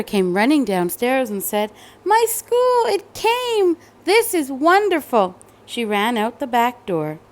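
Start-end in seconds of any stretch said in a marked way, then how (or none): 2.0-5.3 much higher, delighted squeal; thrilled little girl. Acted. Cassandra seeing her school arrive, high and delighted. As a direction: gush with joy.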